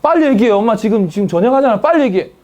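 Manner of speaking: high, loud, impatient urging; hurried impatient mom. Acted. Busy cooking mom urges child on, high and impatient. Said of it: "엄마가 지금 요리하려는데".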